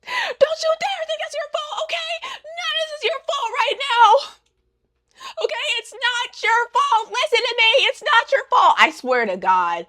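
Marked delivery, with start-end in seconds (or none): 0.0-4.1 high-pitched tearful mock scream; hysterical, very high imitation shriek. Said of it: "this girl starts screaming like". 5.4-8.8 high, tearful mock shriek; mock screaming. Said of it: "this girl starts screaming like".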